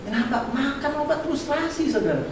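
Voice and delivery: normal talking voice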